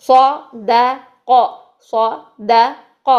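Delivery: صدق split apart, very slow; broken into syllables. Shown as a demonstration. Teacher breaks صدق apart very slowly for pupils. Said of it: "ایسے توڑ توڑ کے".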